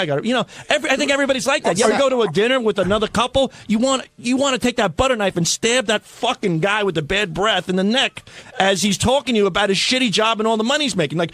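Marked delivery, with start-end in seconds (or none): none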